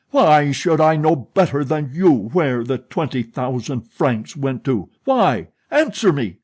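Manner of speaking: loud furious roar. Acted. Manager roars at the old woman, shaking her wrist. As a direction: thunder the demand at her. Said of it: "In a voice growling and rolling like thunder".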